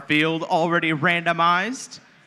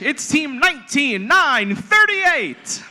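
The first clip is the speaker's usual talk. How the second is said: fighting game announcer; 7 semitones higher than usual. Acted. Co-announcer calls team intro.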